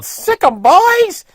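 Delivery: high, loud mock yell egging on a pack of dogs; imitated yelling. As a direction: mimic a woman urging her dogs on, high and loud. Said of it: "She was yelling".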